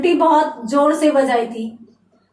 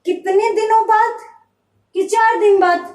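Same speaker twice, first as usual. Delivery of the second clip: suspicious questioning; 6 semitones higher than usual. Retold or imitated. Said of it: "सोच विचार कर यूं कहता है".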